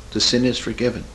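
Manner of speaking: normal talking voice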